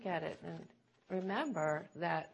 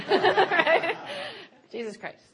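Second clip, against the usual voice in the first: amused, breaking into laughter; real giggly aside to the crowd; laughing; 11 semitones higher, 11 dB louder, slower than usual. Real, not acted. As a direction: laugh through the words, pitched up. Laughing at own name joke.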